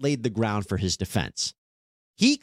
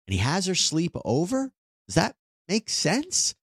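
incredulous rhetorical question; 5 semitones higher than usual. Real, not acted. Host doubting the defendant's sleepover story, incredulous.

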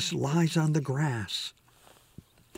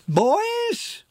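piercing, much higher, louder commanding cry; drill sergeant shout; 3 dB louder than usual. A narrator's reading. Petite mom halting the boys' brawl, piercing drill sergeant shout. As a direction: stop the brawl dead with one sharp command. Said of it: "authoritative drill sergeant voice".

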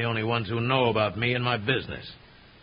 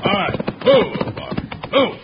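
barking order; 7 semitones higher, 5 dB louder than usual. Acted. Villain orders hostages.

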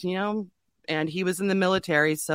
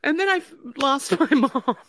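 real tears, high strained voice; crying; 9 semitones higher than usual. Real, not acted. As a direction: break down while getting the words out. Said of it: "oh, I'm gonna start crying".

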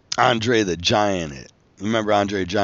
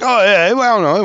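higher, louder flustered stammer; flustered dodge; 11 semitones higher, 8 dB louder than usual. Retold or imitated. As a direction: caught out, scramble for an excuse. Wrestler caught out, flustered.

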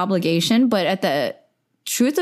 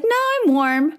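high-pitched quick refusal; 11 semitones higher than usual. Retold or imitated. Retelling turning down the blanket.

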